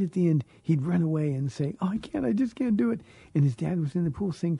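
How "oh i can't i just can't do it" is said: higher, quick mock little boy's whimper; scared little boy. Boy at the pool edge telling dad he can't, in a higher, quick child's voice. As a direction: imitate a timid child backing away.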